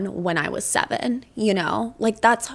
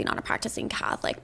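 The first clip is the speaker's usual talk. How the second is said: whispered aside; 7 dB quieter than usual. Real, not acted. Confessional aside mid-argument.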